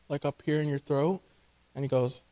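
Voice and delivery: usual speaking voice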